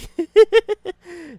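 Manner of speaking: loud, high laugh; real laugh breaking out high and loud; burst of laughter. Real, not acted. Laughing at own dramatic acting, loud and high.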